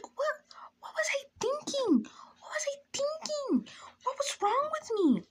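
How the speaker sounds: very high, squeaky mock sob; mock crying. Teller cringing at her past self, mock crying. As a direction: wail it in hammed-up despair.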